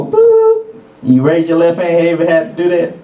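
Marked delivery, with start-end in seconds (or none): 0.0-0.8 high, loud beep noise; imitated test tone. Imitating the hearing test, high beep sound. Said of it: "hear that little voice and you go".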